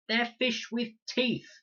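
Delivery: high, loud, slow furious outburst; ferocious. A narrator's reading. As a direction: spit it out in fury. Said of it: "sharp-boned and ferocious".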